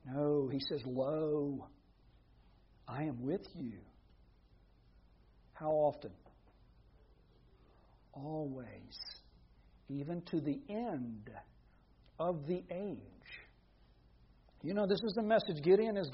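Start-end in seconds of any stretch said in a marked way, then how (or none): 0.8-3.8 low, soft, measured quotation; solemn quiet promise. Retold or imitated. Preacher quoting Jesus's promise to his followers, hushed and solemn. 8.1-13.0 very soft, slow quotation; solemn quiet promise. Retold or imitated. Preacher finishing Jesus's promise to his followers, hushed and solemn.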